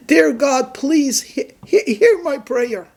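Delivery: high, tearful plea to God; weeping prayer. Acted. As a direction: pray through sobs, voice high. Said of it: "And he weeps".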